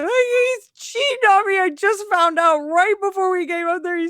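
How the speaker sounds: very high, wailing fake tears; mock ugly crying. Undercover officer improvises a cheating story to older woman, hammed-up crying. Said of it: "I'm crying, ugly crying".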